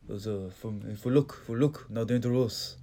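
halting, badly faked French accent; clumsy mock-French traveler. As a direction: attempt a French accent and miss. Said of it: "My French accent needs work".

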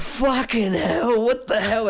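high, slow horrified exclaim; stunned. Retold or imitated. Narrator seeing the plane hit, slow high shock. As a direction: drag it out in shock. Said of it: "And I was like".

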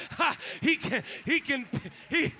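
loud, building proclamation; full force preaching. Acted. Pastor promising God lifts you from the clay, loud and building. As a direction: lift it up with power.

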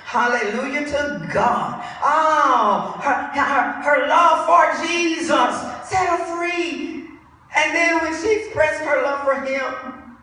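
high, loud exultant preacher; full-force preaching. Real, not acted. Preacher tells congregation of her love for Jesus, exultant preaching. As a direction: proclaim it to the congregation.

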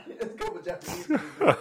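high, loud, breaking up with laughter; laughing. Real, not acted. Comic picturing kids taught by his parents, laughing. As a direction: struggle to get it out while cracking up. Cracking up at own story.

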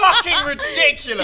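high, laughing outburst; high-pitched incredulous cry; laughing disbelief. Real, not acted. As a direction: exclaim it in amazed laughter. Amazed at the correct answer.

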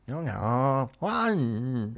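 put-on loud, high caricature of a Thai accent; mock Thai accent. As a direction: exaggerate a Thai accent, stretching the vowels. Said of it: "Thai accent".

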